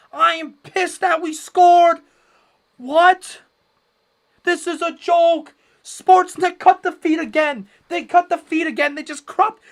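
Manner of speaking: high, loud, slow furious outburst at the broadcast; furious rant. Real, not acted. Streamer yelling at Sportsnet for cropping the feed, furious and loud. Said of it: "I'm losing it".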